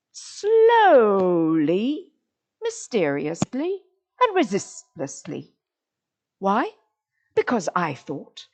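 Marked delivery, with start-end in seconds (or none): 0.1-5.5 "slowly" stretched long, a pause after each adverb; reordered triad. Shown as a demonstration. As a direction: set apart "slowly", "mysteriously", "resistlessly". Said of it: "He should have said".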